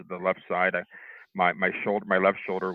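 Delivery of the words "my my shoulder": ordinary talk, nothing marked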